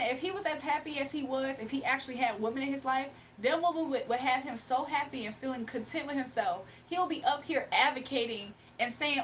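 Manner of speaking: ordinary talk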